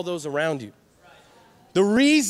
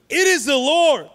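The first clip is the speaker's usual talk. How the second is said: loud, high, drawn-out proclamation; excited call; 6 semitones higher, 5 dB louder than usual. Acted. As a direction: cry it out across the water. Disciple calls out recognising the Lord.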